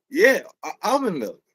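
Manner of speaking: high-pitched imitated insistence; insisting customer. Customer confirming his order, high imitated voice. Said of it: "goes back to the customer".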